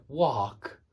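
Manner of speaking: "wok" said slowly as /wɑɹk/ with an American R; American accent. Shown as a demonstration. Teacher imitating how Americans say wok, slow American accent. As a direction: stretch "wok" into an American "wark". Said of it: "They say wok exactly the same way".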